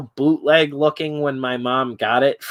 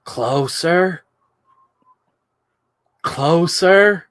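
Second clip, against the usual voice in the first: creepy toy voice; 5 semitones higher, 3 dB louder, much slower than usual. Retold or imitated. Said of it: "he'd be like".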